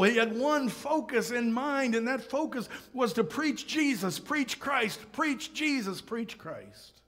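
high, rhythmic pulpit delivery; impassioned preaching. Real, not acted. Preacher declaring Paul's one clear goal, impassioned preaching. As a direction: preach it in driving, repeated phrases.

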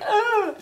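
high squeaky little wilting sigh; mock wilting sigh. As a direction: voice the soggy crisps giving up. Crisps shrivel up sound.

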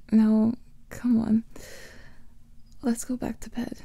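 ordinary talk

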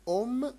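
"om" said loud and high with a light m; nasal om. Shown as a demonstration. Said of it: "أم على شكل أم". ("om" بصوت مرتفع وميم خفيفة)

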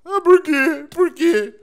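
high mocking echo of a friend; imitating a friend's whiny question; mocking imitation. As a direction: parrot him back, teasing. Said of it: "ela tá me imitando agora?".

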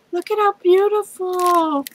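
high, marvelling exclamation; awed delight at a gift; delighted gasp. Real, not acted. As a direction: gush over the beauty. Marvels at trims.

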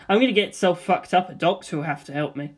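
usual speaking voice

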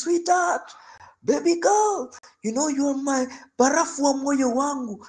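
very high, sugary pleading; coaxing husband. Retold or imitated. Drunk husband begging wife to open, very high and sweet. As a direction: beg sweetly through the door.

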